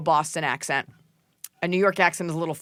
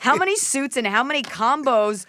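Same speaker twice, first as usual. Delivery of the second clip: higher, hammed-up reading of a letter; dramatic question read-out; 4 semitones higher than usual. Co-host reading a listener's wardrobe question aloud, hammed up. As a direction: read it out with big emphatic swoops.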